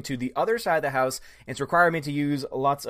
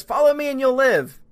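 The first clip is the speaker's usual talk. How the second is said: mock heroic quote; 9 semitones higher, 7 dB louder than usual. Mocking character quote.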